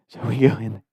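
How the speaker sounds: usual speaking voice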